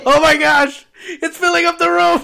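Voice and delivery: high, loud laughing squeal; laughing shriek. Real, not acted. Teasing friend blowing nose.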